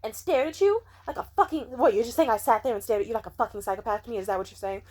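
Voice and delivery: high, loud, fast offended rant; indignant outrage. Retold or imitated. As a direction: fire back, insulted and defensive. Retelling friend's defensive outburst.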